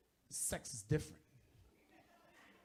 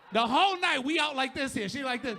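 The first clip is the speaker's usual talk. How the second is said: ordinary talk, nothing marked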